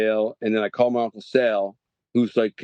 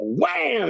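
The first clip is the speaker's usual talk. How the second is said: higher wham; 11 semitones higher than usual. Retold or imitated. Telling of jailhouse fight, higher wham. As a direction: pitch jumps up on a punch sound.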